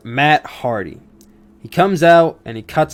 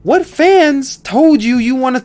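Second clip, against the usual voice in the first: much higher and louder, mocking disbelief; incredulous retort; 10 semitones higher, 5 dB louder than usual. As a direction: fire back at him in loud disbelief. Mock retort to Matt's promo.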